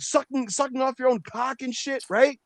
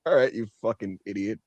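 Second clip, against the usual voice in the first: sarcastic put-down; 14 semitones lower than usual. Retold or imitated. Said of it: "It's like".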